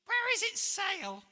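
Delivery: high, loud screeching bird; parrot screech. Acted. Parrot screeching to George about the ship, high and loud. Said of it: "The parrot screeched".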